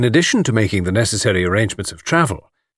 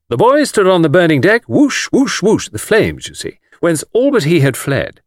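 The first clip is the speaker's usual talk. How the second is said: louder, higher hammed-up recital; recitation with action; 8 semitones higher, 4 dB louder than usual. Anthony showing off for Miss Taylor, theatrical recitation. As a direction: act out the verse with whooshing flames. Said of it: "I can do that bit with action".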